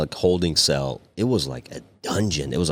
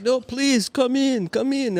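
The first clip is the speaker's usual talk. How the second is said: much higher, slow sugary welcome; overly polite warden; slower than usual. Retold or imitated. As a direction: coax him in, all sweetness.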